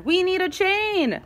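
high, urgent cry; yelled. A narrator's reading. Highlighter explains the rescue plan, yelling. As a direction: push it up high and insistent. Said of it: "yelled Highlighter".

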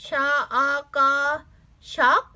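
letters of ช็อก sounded one at a time, then blended; teacher's spelling chant for ช็อก. Shown as a demonstration. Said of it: "อันนี้อ่านว่า".

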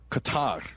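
"Qatar" as kuh-TAR; caller's pronunciation. Shown as a demonstration. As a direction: say "Qatar" stressing the second syllable, TAR. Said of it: "is basically what he's telling us".

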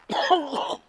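sudden harsh cough; coughing on a bug. Real, not acted. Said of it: "I think I just swallowed a bug".

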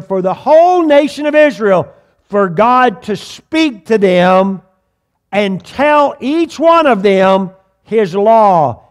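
preaching. Acted. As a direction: hammer home what God desired.

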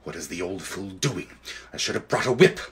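low, soft impatient aside; quietly grumbling witch; as if to herself. A narrator's reading. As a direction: say it under your breath, to yourself. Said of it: "as if to herself".

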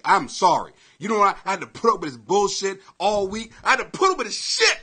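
ordinary talk

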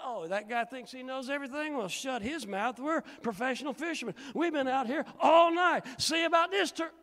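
high, loud indignant rant; grumbling fisherman. Retold or imitated. Preacher voicing Peter scoffing at the stranger, loud and high. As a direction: huff and bluster, climbing. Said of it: "in my head, it went like this".

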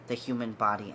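usual speaking voice

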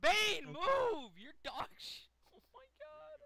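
high-pitched furious outburst; berserk rage. Real, not acted. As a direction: yell at the screen in disbelief. Frustrated at chaotic race.

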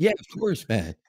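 high, breezy Canadian student voice; a young Canadian partier, pitched well up; Canadian accent. Retold or imitated. Mimicking a Canadian party student, high Canadian accent. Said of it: "in a Canadian accent".

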